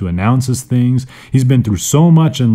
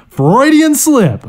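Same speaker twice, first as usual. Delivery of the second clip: excited; 5 dB louder, slower than usual. Real, not acted. Amused at own slip.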